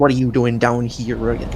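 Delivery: badly faked West Country local; attempted West Country accent. Imagined locals scolding tourists, attempted West Country accent. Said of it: "I can't do a West Country accent".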